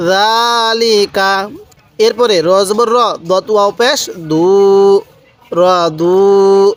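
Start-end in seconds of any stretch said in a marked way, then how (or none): 0.1-1.3 জালিকা read very slowly, the first vowel of জা held one alif long; slow drawn-out recitation. Shown as a demonstration. Teacher reciting জালিকা to students, slowly drawn out. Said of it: "কীভাবে পড়ব". 5.5-6.7 র দু read very slowly, with দু held one alif long; slow drawn-out recitation. Shown as a demonstration. Teacher showing students how to read র দু, slowly drawn out. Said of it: "কীভাবে পড়ব".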